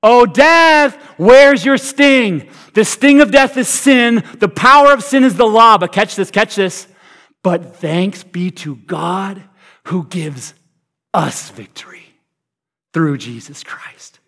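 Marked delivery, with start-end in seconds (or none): 0.0-5.8 apostles' trash talk. Acted. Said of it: "the apostles' trash talk". 7.4-14.2 hushed awe. Acted. Scripture read softly, awed.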